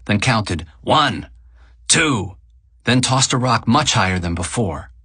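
ordinary delivery, nothing marked

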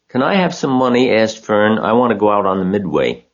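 put-on girl asking her mother for money; begging girl. A narrator's reading.

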